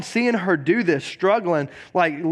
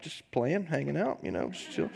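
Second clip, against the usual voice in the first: softer, laid-back mock nonchalance; mock casual; 3 semitones lower, 12 dB quieter than usual. Pastor telling Pastor Lydia he's just playing, softly offhand. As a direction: shrug it off, soft and casual. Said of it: "I was like".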